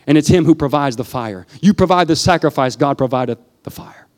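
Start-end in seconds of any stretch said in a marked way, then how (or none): 2.8-4.1 whisper. Real, not acted. Preacher drops to a whisper.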